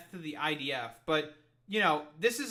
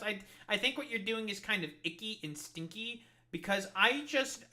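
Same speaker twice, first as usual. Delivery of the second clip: much higher, whiny mock voice, put-on and far off his own; mocking whiny protester; 7 semitones higher, 6 dB louder than usual. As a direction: sneer at them in a prissy high whine. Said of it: "referees to come and be like".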